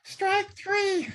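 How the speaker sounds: squeaky helium umpire call. Pretend. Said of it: "here comes that helium".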